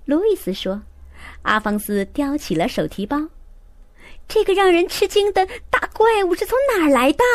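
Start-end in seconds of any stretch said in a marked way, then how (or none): 4.3-7.2 high, astonished exclamation; astonished cry. A narrator's reading. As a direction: cry out in amazement. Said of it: "西弗斯小姐喊道".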